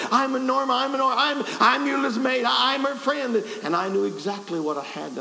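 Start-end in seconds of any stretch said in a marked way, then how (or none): 0.1-3.4 high, loud, stammering introduction; maid's voice. Retold or imitated. Maid introducing herself to the preacher, high and stammering. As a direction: mimic her stumbling over who she is, pitched up. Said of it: "She said".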